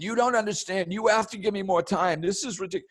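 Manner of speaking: panicked begging on the phone; mock screaming. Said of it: "Screaming at them".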